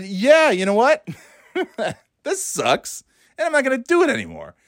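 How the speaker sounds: lightly acted high-pitched exasperation; high, mock fed-up quitter; imitating fed-up people. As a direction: voice someone who's had enough, pitched high.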